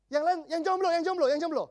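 loud, high call to a crowd; rallying shout-out to the audience. Real, not acted. Calling the singles in the audience.

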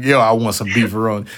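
low-pitched mock craving; mocking quote. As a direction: drop the pitch to mimic a beefaroni fan. Mocking the other's beefaroni craving.

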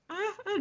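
high breathy mock moan, half-joking; mock moaning sound. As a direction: imitate a suggestive background moan from a phone video, lightly. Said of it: "suara back sound". (tiruan desahan tinggi, setengah bercanda)

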